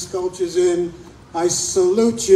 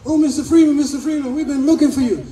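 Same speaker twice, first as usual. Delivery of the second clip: high-pitched, eager quote of two excited ladies; worked-up, breathless-to-tell imitation; 7 semitones higher, faster than usual. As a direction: imitate them gushing, pitch up throughout. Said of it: "they're all excited".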